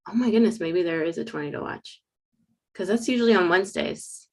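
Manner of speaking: ordinary talk, nothing marked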